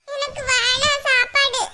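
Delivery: put-on slow, high pouting child voice; sulky little girl. Girl refusing her food, slow sulky child voice. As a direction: pout and refuse like a small child.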